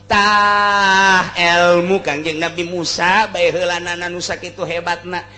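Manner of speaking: usual speaking voice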